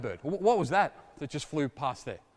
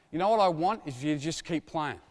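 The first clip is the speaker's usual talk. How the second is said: ordinary delivery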